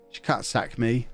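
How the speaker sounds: usual speaking voice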